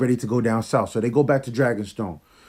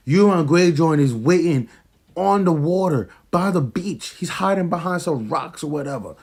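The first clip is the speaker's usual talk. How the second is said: ordinary talk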